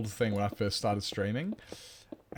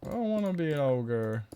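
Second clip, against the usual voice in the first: higher mock whine with an odd accent; silly high voice; 4 semitones higher than usual. Shown as a demonstration. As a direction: whimper it like a cartoon. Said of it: "repeats in silly voice".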